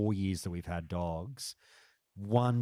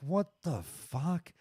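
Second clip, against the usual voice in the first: high, slow stunned what the fuck; mock stunned reaction; retold disbelief; 9 semitones higher, slower than usual. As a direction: drag it out in disbelief. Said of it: "And I was like".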